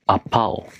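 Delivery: Apao as apple mis-stressed, a-POW; apple wrongly stressed at the end, Apao; stress on second syllable. Shown as a demonstration. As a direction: say Apao with weak A and strong pao. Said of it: "placed stress on the second syllable".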